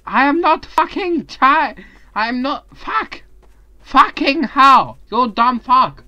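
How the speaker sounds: high, loud yelling in a put-on accent; mock angry yell. Player yelling at a friend, mock angry high shout. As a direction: rage at the other player.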